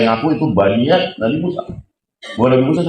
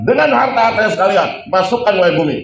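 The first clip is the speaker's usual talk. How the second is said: commanding shout; 9 semitones higher than usual. Acted. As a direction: order the ground to swallow him. Musa commands the earth. (perintah lantang kepada bumi)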